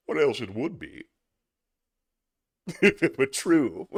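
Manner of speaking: a stuffy schoolmaster addressing a parent; prim, self-satisfied headmaster voice; pompous headmaster. Acted. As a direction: play the smug headmaster lecturing the father. Reads Atkinson headmaster sketch aloud.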